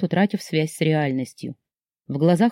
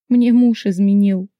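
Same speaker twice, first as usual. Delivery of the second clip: slow, high, voiced lament; tearful confession; 4 semitones higher, 5 dB louder than usual. A narrator's reading. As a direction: confess as if through tears. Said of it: "прошептала, заливаясь обжигающими слезами". (сквозь слёзы, протяжно)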